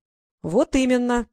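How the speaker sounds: slow, knowing and pointed; lightly voiced knowing tone; meaningful. A narrator's reading. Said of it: "многозначительно выгнув бровь".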